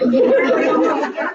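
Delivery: big high laugh; loud laugh. Real, not acted. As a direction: let a loud laugh burst out. Laughs at own joke.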